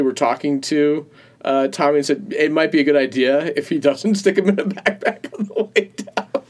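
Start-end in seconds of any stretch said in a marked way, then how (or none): 2.4-6.4 giggling through the quote; can't hold back the laugh. Real, not acted. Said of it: "I can't say that without laughing".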